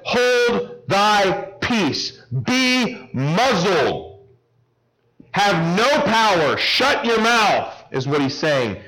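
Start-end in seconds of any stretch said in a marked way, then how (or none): 0.0-7.7 slow, deliberate, commanding rebuke; stern rebuke. Acted. Jesus rebuking the unclean spirit, slow stern command. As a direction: muzzle the demon with each slow word. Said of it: "Jesus rebuked the demon and said".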